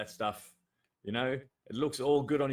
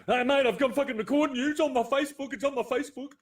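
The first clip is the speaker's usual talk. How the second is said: much higher, loud taunting of the cop; mock shouting filmer; 13 semitones higher, 4 dB louder, faster than usual. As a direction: play the smug guy waving his phone at police. Mocking shouty phone-filming citizen.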